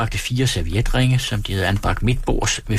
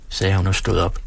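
ordinary delivery

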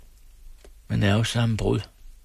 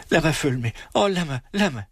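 high, desperate begging; frantic pleading; 7 semitones higher, faster than usual. Acted. Else begging the nurse frantically to take her along. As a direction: beg beside yourself. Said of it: "bad Else ud af sig selv".

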